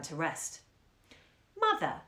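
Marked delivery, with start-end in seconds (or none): 1.6-2.0 put-on much higher piping little girl; little girl bird voice. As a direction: chirp it like a small bird. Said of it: "in her little girl bird voice".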